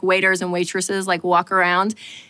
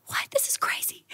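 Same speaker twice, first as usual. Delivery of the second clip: unvoiced, hushed disbelief; whispered thought; 12 dB quieter than usual. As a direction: breathe it to yourself in shock. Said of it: "I thought".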